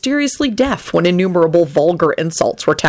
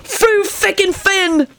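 very high, drawn-out mock-emotional cry; gasped; much slower than usual. A narrator's reading. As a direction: gasp it out slowly, overcome. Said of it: "Sirius gasped".

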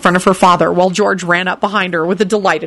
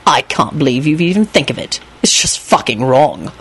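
insolent scolding; 4 semitones lower than usual. Acted. Lupin taunts Quentin over the cake.

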